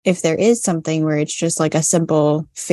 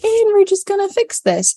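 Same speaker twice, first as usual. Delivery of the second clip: squeaky high self-quote. Retold or imitated. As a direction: jump way up into a squeaky voice. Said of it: "I'm like".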